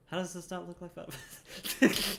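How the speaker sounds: slow, high words through a laugh; amused laughing question. Real, not acted. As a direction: ask while laughing. Laughing at the view.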